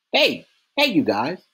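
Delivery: a man who forgot where he is, thrilled; laughing, amazed. A narrator's reading. Delacroix calls the guards over, laughing and amazed. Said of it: "the laughing, amazed voice".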